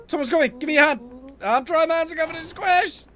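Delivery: high, urgent acted panic; scrambling to hide as someone approaches; panicked urgency.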